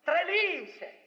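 high, mocking dismissal; the brothers scoffing at the servant girl; scornful brothers. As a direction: brush her off as crazy. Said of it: "Ετούτοι είπαν".

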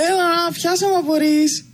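put-on high, playful boy's voice; teasing child. A narrator's reading. Boy in the tree teasing his father to catch him. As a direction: taunt him like a cheeky kid.

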